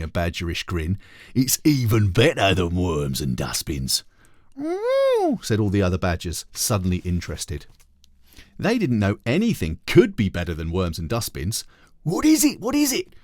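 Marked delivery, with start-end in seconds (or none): 1.3-4.0 drawn-out, sly badger boss; slow sly badger voice. Harry the Badger grins. 4.6-5.4 very high, interested little "oh"; high chorus of badgers. 12.0-13.1 very high, excited repeated question; excited badger gang pestering, high; eager squeaky badgers.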